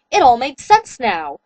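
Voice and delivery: ordinary delivery, nothing marked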